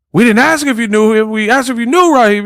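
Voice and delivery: high-pitched caricature of an interrogator; mock insistent questioning. Cops interviewing him about Raheem, high mock insistence. Acted cop interrogating a suspect.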